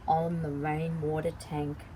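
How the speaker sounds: ordinary delivery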